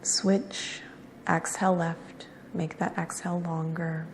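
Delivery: soft, unhurried yoga-teacher cues; guided breathing meditation. Real, not acted. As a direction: guide each breath quietly, with long pauses between cues.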